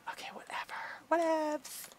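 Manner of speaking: breathy shrug of a whisper, under the breath; dismissive whisper. Real, not acted. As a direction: whisper it off like it doesn't matter.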